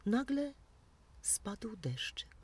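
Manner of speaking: sudden high word then a slow whisper; hushed reveal. A narrator's reading. The storyteller revealing the rain to young listeners in a hushed whisper. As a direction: drop to a hush for the rain. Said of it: "storyteller hush".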